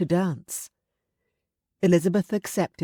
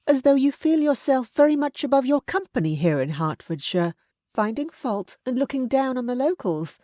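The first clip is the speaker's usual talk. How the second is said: higher, firm matronly voice; stern aunt; 8 semitones higher than usual. A narrator's reading. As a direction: scold him like a frank aunt. Aunt scolds Darcy.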